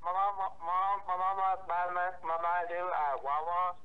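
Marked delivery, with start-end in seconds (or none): none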